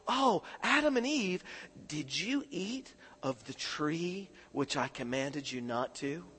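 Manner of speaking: tender God. Acted. Preacher voicing God gently questioning Adam and Eve. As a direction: ask it gently like a caring father. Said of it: "But God's saying".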